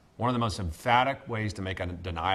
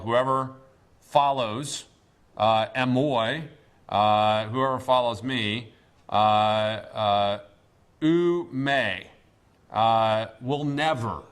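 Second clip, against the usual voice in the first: slow and deliberate, leaning on follows and never; slow, weighty reading of a warning; emphatic negative; 4 dB louder, much slower than usual. Shown as a demonstration. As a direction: spell the Greek out word by word, pressing never. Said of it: "this is the emphatic negative".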